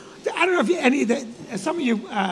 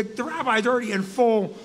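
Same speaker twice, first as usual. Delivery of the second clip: usual speaking voice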